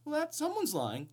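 mock gasp of surprise; sarcastic. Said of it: "a sarcastic way of saying".

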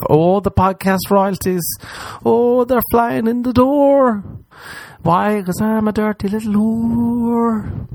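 high, slow, sing-song vamp; camp diva voice. Pretend. Host bragging about royalties in a camp vamp voice. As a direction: purr it like a showgirl.

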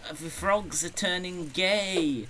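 high, drawn-out mock co-host; Richard Miller impression. Teasing the co-host to his face, high put-on voice. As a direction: send up a friend in a high voice. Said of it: "do an impression of me".

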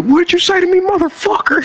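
high, furious comeback; angry challenge. Retold or imitated. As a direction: square up for a fight. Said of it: "He was like".

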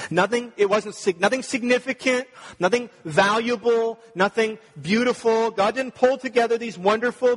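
loud, high, driving sermon; preaching at full force. Acted.

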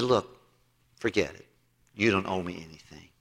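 king forgiving a huge debt, low and gentle; compassionate king. Retold or imitated. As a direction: let him off the hook tenderly. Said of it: "The king had compassion upon him".

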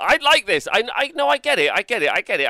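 high, loud burst of excitement; excited. Real, not acted. Streamer finally getting the yellow joke, excited. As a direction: blurt it out repeatedly, high and loud. Sudden delight at the pun.

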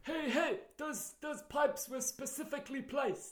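high, slow, fussy complaining voice; mock commentator. Riffing on the friend's impression, high and prissy. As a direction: whine like a host whose props got knocked.

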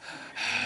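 heavy breath, no voice; imitated heavy breathing. Kidnapper picks up the phone, imitated heavy breathing. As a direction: breathe heavily into a phone, play-acted. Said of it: "like, breathing all heavy".

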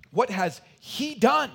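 loud, high and slowed for weight; emphatic question. Acted. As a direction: drive the question home. Rhetorical question, preacher's peak.

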